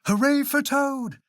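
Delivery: high, excited cheer; high-pitched hooray, moderately loud; shouted cheer. A narrator's reading. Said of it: "as he shouted".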